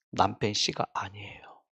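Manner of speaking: hushed confiding whisper; secret whisper. Retold or imitated. Telling the crowd a scandalous secret, whispered.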